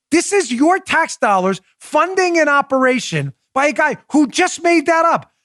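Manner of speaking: high-pitched, exasperated complaint; indignant disbelief. Real, not acted. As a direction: drive the outrage home to listeners. Indignant at publicly funded misinformation.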